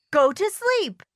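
high-pitched exclamation; excited shout. A narrator's reading. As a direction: cry out as if suddenly remembering. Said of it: "shouted Toad".

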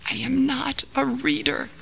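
high, thin half-whisper. Acted. Frightened man answers the mysterious voice, whispered. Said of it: "whispered the man".

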